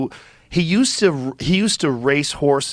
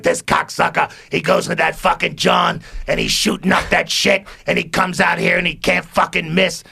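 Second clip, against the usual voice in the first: higher, heated grumbling rant; angry griping; 4 semitones higher than usual. Retold or imitated. George gripes about Water Dog at the table, heated and higher. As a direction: spit out the complaint like a sore loser. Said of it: "he was so angry".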